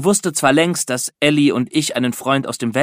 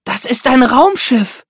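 much higher and louder, slow and triumphant; thrilled shout; 11 semitones higher, 5 dB louder than usual. A narrator's reading. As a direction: announce the spaceship in pure delight. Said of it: "rief sie aufgeregt". (begeisterter Ausruf, viel höher und lauter, langsam)

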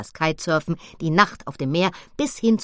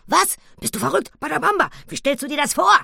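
very high, loud, tumbling outcry; both shout over each other in alarm; wild shouting; 10 semitones higher, 5 dB louder, faster than usual. Acted. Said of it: "wild durcheinander zu rufen". (wild durcheinander, hoch und laut gerufen)

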